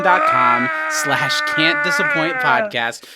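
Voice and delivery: slow, high drawn-out plug; ad read. Acted. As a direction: stretch the URL like an announcer.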